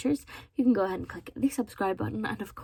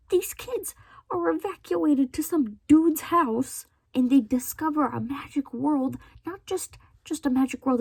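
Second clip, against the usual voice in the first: indignant disbelief; 4 semitones higher, 4 dB louder than usual. Real, not acted. Defending the wardrobe story from a reviewer, indignant disbelief. Indignant retelling of the book's plot.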